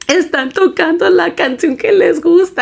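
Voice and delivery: tearful excitement. Retold or imitated.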